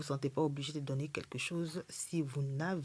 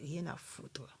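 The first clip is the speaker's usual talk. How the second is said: whispered aside; 8 dB quieter, slower than usual. Real, not acted. Dismissing critics of her accent.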